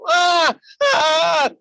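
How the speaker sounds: very high loud mock wail. Little boy crying again in the street, loud high wail. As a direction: ham up a child's crying wail.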